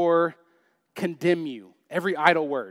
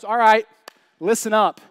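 loud, raised-pitch challenge easing off; God rebuking; 4 semitones higher, 5 dB louder than usual. Retold or imitated. God answering Job's complaints, stern rebuke. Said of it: "God goes".

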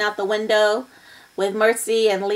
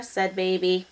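ordinary delivery, nothing marked

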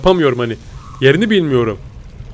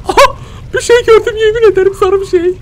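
sudden high-pitched startled cry; startled high yelp. Real, not acted. Gamer spotting a monster, high startled cry. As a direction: blurt it out in a jolt of alarm. Spots something yellow in horror game.

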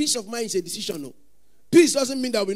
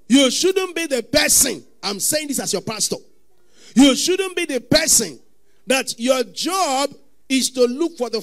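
louder, higher, slow warning; stern preaching; 4 semitones higher, 7 dB louder, slower than usual. Acted. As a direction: press the warning home. Pastor warning the congregation.

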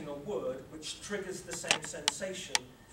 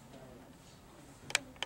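soothing relax; 3 semitones lower, 3 dB quieter, much slower than usual. Real, not acted. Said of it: "guiding muscle relaxation".

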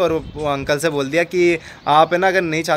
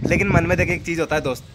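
ordinary talk, nothing marked

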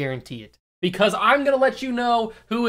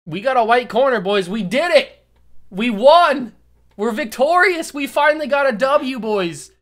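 loud, high mock victory cheer; hammed-up triumphant shout to the boys; mock celebration; 5 semitones higher, 5 dB louder than usual. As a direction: cheer it like a big win, tongue in cheek. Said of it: "I can't even say that with a straight face".

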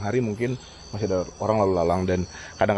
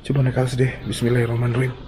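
ordinary talk

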